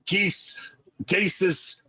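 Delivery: show-host patter in a Scottish-Irish brogue; Scottish-Irish accent. Shown as a demonstration. Host introducing a co-host as a guilty pleasure, in a Scottish-Irish accent. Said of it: "I did do a Scottish-Irish accent".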